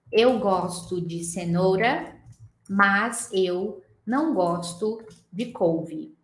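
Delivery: Eu gosto de cenoura said very slowly, each word separate; slow careful Portuguese. Shown as a demonstration. Teacher saying a Portuguese sentence slowly and unlinked. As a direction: spell the sentence out slowly, no linking. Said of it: "eu viro pra você e falo assim".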